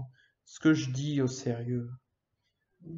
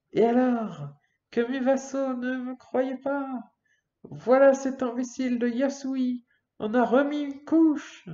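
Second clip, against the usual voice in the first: higher, louder gloating voice; boastful villain; 10 semitones higher, 5 dB louder than usual. Acted. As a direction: crow over them, raised and loud.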